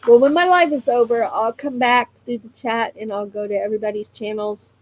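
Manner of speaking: ordinary delivery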